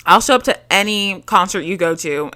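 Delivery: ordinary talk, nothing marked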